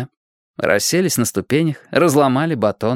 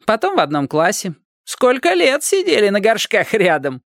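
put-on much higher cheeky boy voice; teasing boy; 9 semitones higher than usual. A narrator's reading. Upside-down boy teases the neighbours, high and cheeky. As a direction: needle them like a little brat.

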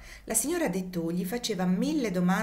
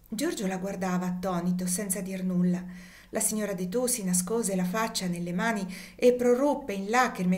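plain reading voice

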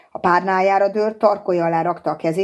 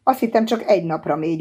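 ordinary delivery

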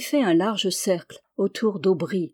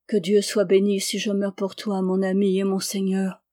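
plain reading voice

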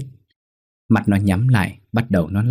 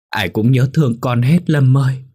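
higher, louder tearful cry; tearful mother; 6 semitones higher, 4 dB louder than usual. Acted. Mother weeping to her son's spirit, tearful high cry. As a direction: cry it out with longing.

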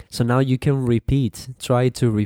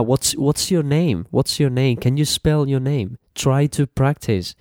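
ordinary delivery

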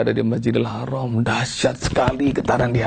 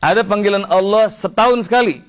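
high, ringing scripture declamation; impassioned preaching; 6 semitones higher, 3 dB louder than usual. Real, not acted. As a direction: proclaim it to the congregation, drawing out the verse. (seruan dakwah lantang bernada tinggi)

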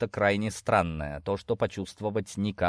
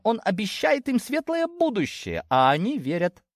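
high-pitched voice making a heated case; indignant daughter; 10 semitones higher than usual. Acted. Daughter explaining her friends' blind loyalty, indignant. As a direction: argue the point, pitched well up.

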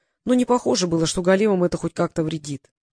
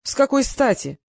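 indignant; 6 semitones higher than usual. A narrator's reading. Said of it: "возмутился прозрачный бог".

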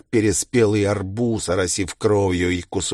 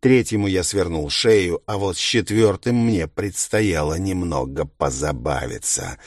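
usual speaking voice

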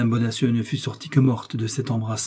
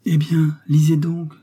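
higher, louder triumphant urging; proud, joyful; 5 semitones higher, 6 dB louder, slower than usual. A narrator's reading. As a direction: burst out with pride and joy. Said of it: "dans l'excès de son orgueil, de sa joie". (avec orgueil et joie)

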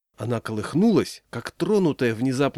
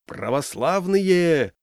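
long drawn-out, higher-pitched call; booming call; 6 semitones higher, 3 dB louder, much slower than usual. A narrator's reading. Village leader calling the crowd to order, booming call. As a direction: hail the crowd, stretching the word out. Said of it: "зычно крикнул Егор".

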